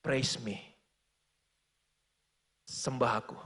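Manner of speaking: low, soft voice of God speaking; a lightly voiced, hushed God calling him to worship; Frank's God speaks softly. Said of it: "Tuhan ngomong sama dia".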